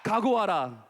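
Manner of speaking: rallying call-and-response lead; leading a repeat-after-me. Shown as a demonstration. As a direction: call it out for the children to echo. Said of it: "다시 한번".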